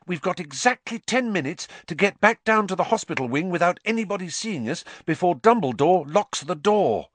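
urgent warning. A narrator's reading. Hermione warns Harry time is running out, urgent. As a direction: press every point of the deadline.

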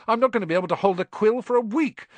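high, loud, fast complaint; indignant grumble. Acted. Ron complaining about his bandaged hand, indignant. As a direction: rattle it off in outrage.

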